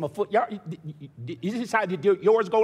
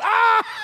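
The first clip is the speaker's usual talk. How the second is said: high, loud ticklish shriek; sudden loud burst of a cry; ticklish scream; 7 dB louder than usual. Retold or imitated. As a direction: yelp as the tickle finally breaks you. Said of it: "I just bust out".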